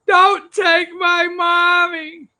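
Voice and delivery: hammed-up sobbing little girl; mock crying child wail. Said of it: "that's crying".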